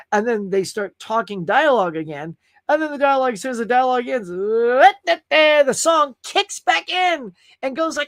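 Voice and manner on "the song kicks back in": drawn out. Real, not acted. Exasperated about long songs.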